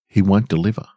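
ordinary delivery, nothing marked

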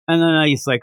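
ordinary talk

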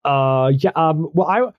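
stammering, flustered invitation; nervous. Acted. Shy goth girl asking Earl to the party, nervous and stammering. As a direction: trip over your words, backtrack, restart. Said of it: "she was so nervous".

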